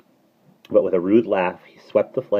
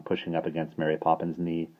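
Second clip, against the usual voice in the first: ordinary delivery, nothing marked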